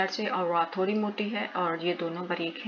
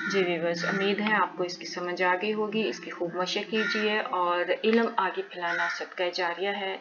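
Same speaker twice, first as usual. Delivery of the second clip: usual speaking voice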